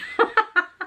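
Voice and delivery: short high giggle at her own story; amused laugh. Real, not acted. Laughs after own bird story.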